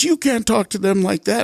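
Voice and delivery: mild mock reproach; scolding passerby. Passerby objects to the swearing.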